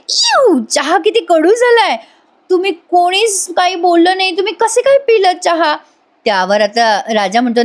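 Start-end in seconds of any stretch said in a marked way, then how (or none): 0.1-5.8 high, squealing disgust at bitter tea. Retold or imitated. Avani tasting the bitter tea, high disgusted squeal.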